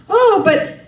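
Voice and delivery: high, loud, emphatic reassurance; mock-reassuring local man. Acting the man's emphatic reassurance.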